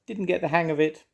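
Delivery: high, small bird voice; a young bird sheepishly admitting it; little bird voice. A narrator's reading.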